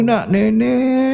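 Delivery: put-on high, slow whiny wail of a baby wanting milk; mock wailing baby; mock crying baby. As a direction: ham up a crying infant begging for the breast. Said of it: "Menangis dia".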